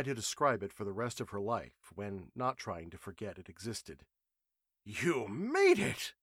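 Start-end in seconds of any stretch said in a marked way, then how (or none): 4.8-6.1 high, slow, delighted cry; jubilant. A narrator's reading. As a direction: greet them with triumphant joy. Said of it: "Professor Trenchley's jubilant voice".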